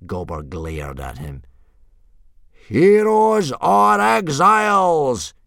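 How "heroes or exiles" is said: huge, slow, high bellow; yell. A narrator's reading. Instructor rallying the boys, yelling. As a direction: draw out a rallying war cry. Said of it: "yelled Gobber the Belch".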